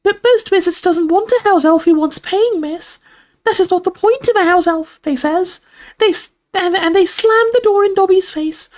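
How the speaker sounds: very high, squeaky, emphatic elf; earnest house elf. A narrator's reading. Dobby tells of being turned away, emphatic squeak.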